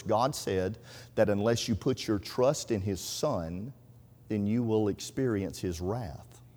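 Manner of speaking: low, soft, slow and deliberate; quiet solemn warning. Retold or imitated. Brother explains the verse to the rabbi, quiet solemn warning. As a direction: spell out the warning gently, unhurried.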